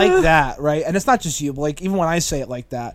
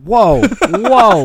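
higher, louder alarmed whoa; startled protest; 5 semitones higher, 9 dB louder than usual. Real, not acted. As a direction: push back fast, shocked. Protesting an accusation on air.

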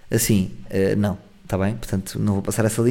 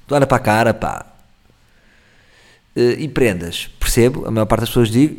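ordinary delivery

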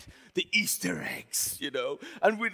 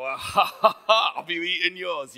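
loud gloating "ha ha ha"; taunting mock laugh; 8 dB louder than usual. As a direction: rub it in with a forced, loud laugh. Rehearses taunting sister with egg.

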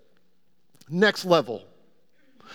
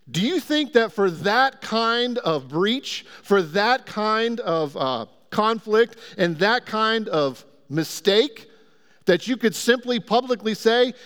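normal talking voice

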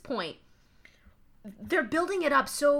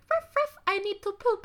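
put-on French poodle accent; 10 semitones higher than usual. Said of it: "that poodle should not have an accent".

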